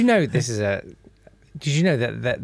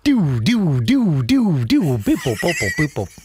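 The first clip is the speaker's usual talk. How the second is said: toy robot beeps; slower than usual. Retold or imitated. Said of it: "does exactly the same noises".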